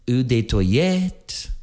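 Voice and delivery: put-on French accent. Host showing off the French name of a fragrance, French accent. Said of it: "my French impression there".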